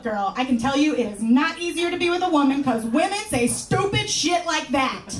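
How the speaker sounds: normal talking voice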